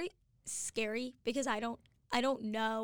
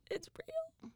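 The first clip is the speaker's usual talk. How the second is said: hushed insistence; 7 dB quieter than usual. Real, not acted. Insisting climate change is real.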